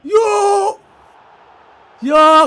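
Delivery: high, loud yell; excited yell. Real, not acted. Streamer yelling as the keeper rushes out, excited.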